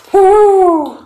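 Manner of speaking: long, very high, loud "woo"; celebratory whoop. Real, not acted. Player cheering as combat ends, high whoop. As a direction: let out a high held whoop.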